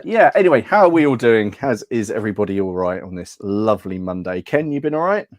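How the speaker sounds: usual speaking voice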